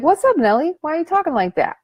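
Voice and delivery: high, teasing confront of inner critic; cheeky challenge to the inner voice; sassy call-out. Pretend. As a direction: call her out, playful and high.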